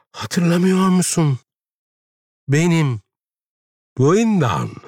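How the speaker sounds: high, imploring woman; pleading. A narrator's reading. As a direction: plead to be remembered, pausing between words. Linda pleads to be remembered. (yalvaran, tiz bir sesle)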